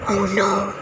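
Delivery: quiet gasp and worried oh no; hushed mock alarm; mock fright. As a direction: act scared for the kids. Reader frightened by wolf.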